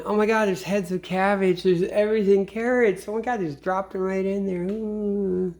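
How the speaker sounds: high, mock-thrilled rabbit voice; excited rabbit. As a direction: marvel at the pile of food like a greedy bunny.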